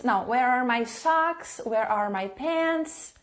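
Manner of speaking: usual speaking voice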